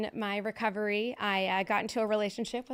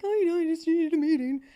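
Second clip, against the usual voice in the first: high, loud mock wail; hysterical crying, imitated; 8 semitones higher, 6 dB louder than usual. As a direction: squeal it out like a hysterical wreck, hammed up. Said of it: "I'm crying hysterically".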